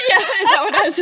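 laughing. Real, not acted. Sister laughing at her own quoted question, laughing.

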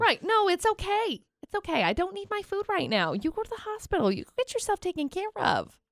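high, soft, overly sweet customer voice; mock understanding customer. Mocking a customer who tells the stabbed driver to go to the hospital, high and sweet. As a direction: play the saintly customer who forgives everything.

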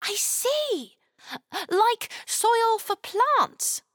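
put-on high, eager little girl; curious child asking how to help; young girl voice. Chloe asks.

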